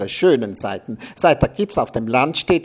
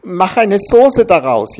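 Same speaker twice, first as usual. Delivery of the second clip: higher, louder, slow whine; a pleading, whiny suggestion; whining; 8 semitones higher, 10 dB louder than usual. A narrator's reading. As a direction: wail it helplessly. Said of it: "jammerte er".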